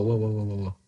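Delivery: ordinary talk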